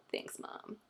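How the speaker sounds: breathy whisper to mom; whispered aside. Real, not acted.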